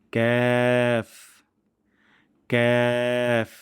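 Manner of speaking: long ক্যাফ with light ae vowel; thin kaf. Shown as a demonstration. Teacher modelling for practice, long thin ক্যাফ. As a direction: hold ক্যাফ thin and even. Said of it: "একটু পাতলা সাউন্ডে".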